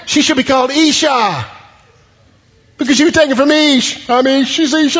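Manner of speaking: high, playful, happy voice; joking delight. Acted. Preacher voicing Adam joking about Eve's name, playfully high. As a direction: toss off the pun with glee. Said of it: "he makes a little joke".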